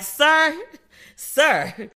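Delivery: punched, emphatic address; loud calling out. Retold or imitated. Woman calling out man for big man talk, loud. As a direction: call him to attention, loudly.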